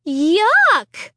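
loud falling groan of disgust. A narrator's reading. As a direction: groan it, sickened. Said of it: "I groaned".

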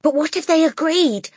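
put-on high, loud, hopeful child; eager cry. A narrator's reading. Matilda seizes hope of staying, eager high cry. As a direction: jump on the idea eagerly. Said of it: "Matilda cried eagerly".

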